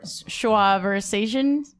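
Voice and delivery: slow "Schwa versations?" spelled out syllable by syllable; very slow "Schwa versations?" with rising doubt; careful pronunciation. Shown as a demonstration. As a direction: sound out "Schwa versations?" slowly as a question. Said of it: "How would you pronounce this word?".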